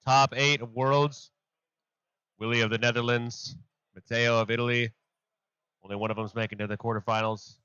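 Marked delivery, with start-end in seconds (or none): 0.0-4.9 slow, high, loud broadcast opener; live announcer. Acted. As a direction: announce it like going live on air.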